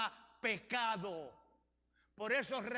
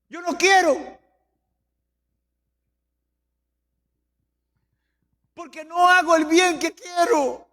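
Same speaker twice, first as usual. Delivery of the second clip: strained, impassioned scripture quoting; full-force preaching; 6 semitones higher, 9 dB louder than usual. Real, not acted. Preacher voices Paul's inner struggle, full-force preaching. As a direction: cry out Paul's struggle to the church.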